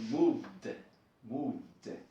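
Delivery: moved with a clearly voiced, stretched final D; moved, final D overdone for the class; exaggerated D. Shown as a demonstration. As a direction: lean on the D of moved. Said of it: "La D la estamos exagerando".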